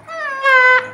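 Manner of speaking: put-on high, loud childish no; little Tiur. Tiur answering her big sister, in a high child voice. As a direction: blurt it out like a cheeky small child.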